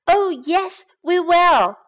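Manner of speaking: put-on high, loud eager kids' voices; bright promise from the kids; eager children. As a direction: chime in like excited little boys. Boys answer eagerly.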